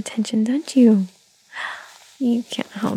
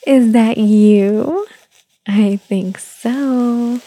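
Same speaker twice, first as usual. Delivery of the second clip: put-on drawn-out, louder singsong coo to a baby; slow baby talk; 8 dB louder, much slower than usual. Mother cooing slowly at her baby, singsong. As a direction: stretch each word like cooing.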